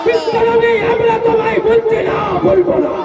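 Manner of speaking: chanting crowd-rousing sermon shout; fiery preaching. Acted. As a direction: rouse the crowd from the stage. Fiery sermon against insulters.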